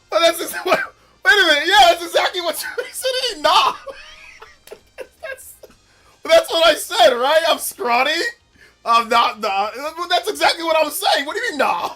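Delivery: high, loud, cracking-up disbelief; laughing protest. Real, not acted. Streamer to viewer who said nah, laughing protest. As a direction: protest loudly through real laughter.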